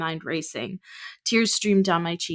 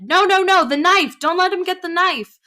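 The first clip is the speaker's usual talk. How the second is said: panicked shout; 12 semitones higher, 9 dB louder than usual. Acted. Father panicking over knife.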